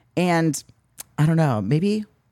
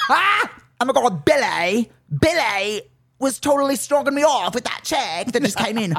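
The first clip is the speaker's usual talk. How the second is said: high-pitched, drawn-out mock British; British woman; 6 semitones higher than usual. British woman gossiping about Billy, high mock British accent. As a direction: ham up a British reality-show accent. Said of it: "Me as a British woman".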